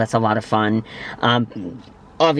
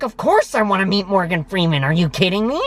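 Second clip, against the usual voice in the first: excited; 7 semitones higher than usual. Retold or imitated. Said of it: "And I was like".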